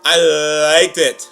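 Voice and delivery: loud, drawn-out verdict; emphatic approval. Real, not acted. Reviewer gives verdict on the episode, loud and drawn-out. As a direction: stretch it out slowly and loudly.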